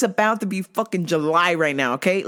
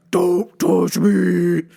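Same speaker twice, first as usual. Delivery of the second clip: long, strained, drawn-out cry; mock loud warning; 4 dB louder, much slower than usual. Worker warning the stalker across the whole store, mock yell. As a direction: stretch every word into a hammed-up yell. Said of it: "I am gonna get so loud".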